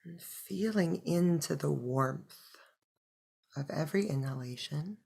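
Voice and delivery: soft, unhurried meditation voice; hushed breath-by-breath guidance; guided meditation. Acted. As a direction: guide them gently into stillness, with long pauses.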